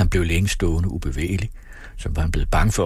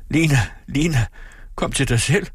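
high-pitched urgent calling, moderate volume; 8 semitones higher than usual. A narrator's reading. Husband calls into his fainted wife's ear, urgent cry. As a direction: call her back to her senses. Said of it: "råbte han hende ind i øret".

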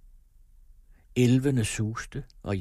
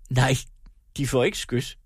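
eager; 4 semitones higher, 5 dB louder than usual. A narrator's reading. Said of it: "gentog Andreas ivrigt".

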